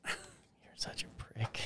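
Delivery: breathy whispered jab. Real, not acted. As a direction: hiss it under the breath. Quiet aside insult.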